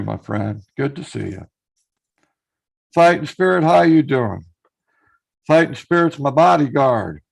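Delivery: ordinary talk, nothing marked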